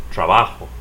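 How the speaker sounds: "trabajo" as tra-BA-ho; Spanish "trabajo", middle syllable stressed; stress next-to-last syllable. Shown as a demonstration. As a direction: lean on "ba" in "trabajo". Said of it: "stress the next to the last syllable".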